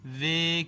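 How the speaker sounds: long-vowel demonstration of "وے"; full-length "وے". Shown as a demonstration. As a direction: hold the vowel of "وے" out completely. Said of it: "یہ مکمل".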